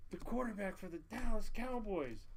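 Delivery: mock awed explanation; starstruck nurse. Nurse explains to mom, retold.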